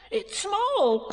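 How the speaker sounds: very high squeal of discovery; squeaky burst of excitement; excited cry. Acted. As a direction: cry it out like a thrilled little animal. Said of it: "cried Piglet".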